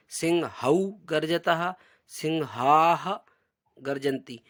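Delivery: सिंहौ and सिंहाः said slowly with a breathy ह after the ग; alternate pronunciation. Shown as a demonstration. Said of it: "ऐसा भी इसका उच्चारण हो सकता है". (सिंहाः को धीरे से, ह साफ़ बोलते हुए)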